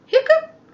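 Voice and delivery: a sudden high squeaky hiccup sound; loud hiccup. Acted. Elephant hiccups, a high loud hiccup. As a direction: pop out a big comic hiccup.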